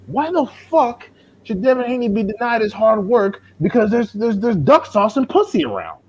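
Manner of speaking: high-pitched, exasperated outburst; indignant rant. Real, not acted. Fan protesting boxers ducking Devin Haney, indignant rant. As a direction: pitch way up in outraged disbelief.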